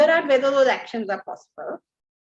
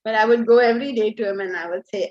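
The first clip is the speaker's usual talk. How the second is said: usual speaking voice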